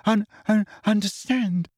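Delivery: hurt, pleading through tears; high tearful voice breaking on the stammers. A narrator's reading. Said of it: "tears spattering down onto the table".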